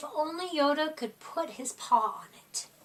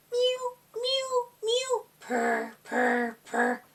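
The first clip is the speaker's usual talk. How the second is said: kitten mews and purrs; 8 semitones higher, slower than usual. Acted. Narrator voicing kittens behind the closed door, mewing and purring. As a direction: mew like tiny kittens, then purr.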